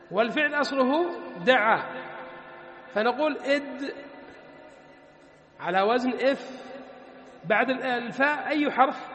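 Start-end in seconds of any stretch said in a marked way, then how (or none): none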